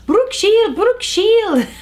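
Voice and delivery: high-pitched mock catcall, calling out excitedly; imitated street yell. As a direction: yell it out like admirers across the street. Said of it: "Filipino guys yelling out".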